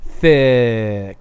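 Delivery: slow, drawn-out "thick" meaning curvy; lingering, drawn-out "thick". Shown as a demonstration. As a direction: stretch "thick" long and slow. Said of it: "like T H I C K".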